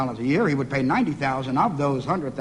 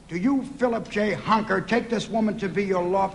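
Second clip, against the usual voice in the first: higher-pitched wedding vow recital; mock wedding officiant; 4 semitones higher than usual. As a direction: recite it like an officiant asking for vows. Acts a wedding officiant testing the name.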